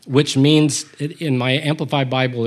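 ordinary delivery

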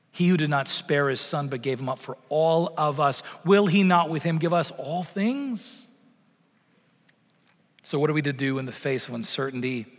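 ordinary talk, nothing marked

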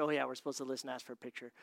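bored low mumble; mumbled. Retold or imitated. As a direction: toss it off under your breath. Man reluctantly recalls the instructions.

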